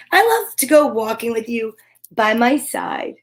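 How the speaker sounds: gentle sing-song picture-book reading; reading to children. Acted. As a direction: read it warmly to small kids.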